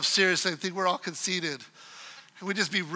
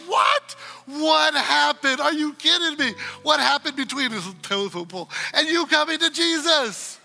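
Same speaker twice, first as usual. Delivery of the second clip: much higher, loud and incredulous; amazed shouting; 7 semitones higher, 4 dB louder than usual. Retold or imitated. Pastor demanding how his friend came to Jesus, incredulous shout. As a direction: cry out in stunned disbelief. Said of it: "I was, like, blown away by it".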